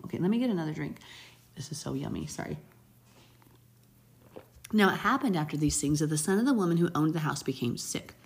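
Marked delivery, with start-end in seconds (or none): none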